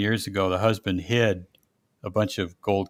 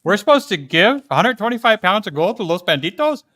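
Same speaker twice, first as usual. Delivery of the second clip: mock disbelief, said higher and louder; incredulous question; 9 semitones higher, 6 dB louder than usual. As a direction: ask it as if you can't believe it. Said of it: "we're like".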